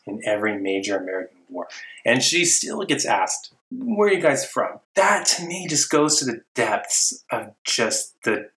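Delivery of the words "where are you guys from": mock prying question, pitched up.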